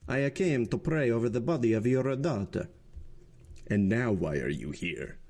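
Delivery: cold, calm clerical voice; measured and unmoved, a priest's voice. Acted. The Abbé coolly answering the magistrate, calm and even. The Abbé Busoni speaks calmly.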